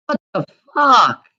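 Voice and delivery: real exasperated cry; angry outburst. Real, not acted. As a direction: blurt it out in disbelief. Outraged at the hospital refusal.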